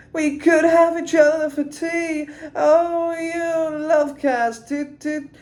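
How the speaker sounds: high, slow, sung-spoken croon; half sing, half tell it up high; high slow talk-singing British croon; melodic storytelling. Shown as a demonstration. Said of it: "telling a story ... in a melodic way".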